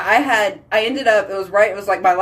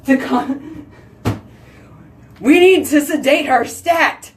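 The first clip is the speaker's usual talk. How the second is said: mock doctor order; 6 semitones higher, slower than usual. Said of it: "imagined doctors ordering sedation".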